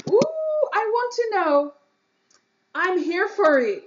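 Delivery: high, loud and eager; thrilled, can't wait to hear; excited. Real, not acted.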